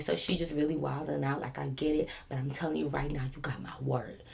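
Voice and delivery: soft, earnest assurance; calm and collected. Retold or imitated. Giving the officer her word, calm and soft. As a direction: give your word quietly and steadily. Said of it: "I try to be the calm, cool, collective one".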